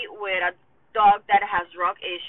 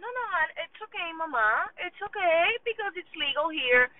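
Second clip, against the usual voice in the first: lightly voiced sweet, soothing doctor; high, fast soothing vet voice; doctor reassuring; 7 semitones higher than usual. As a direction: coo it like a kind nurse calming a mom. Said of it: "And the doctor's like".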